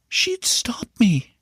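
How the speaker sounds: slow breathy whisper. A narrator's reading. Boy confides to his uncle about his mother, whispering. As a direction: whisper it like a secret.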